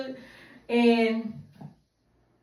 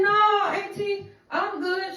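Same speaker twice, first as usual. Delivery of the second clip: high slow reply; 8 semitones higher, slower than usual. Retold or imitated. High niece replying to auntie.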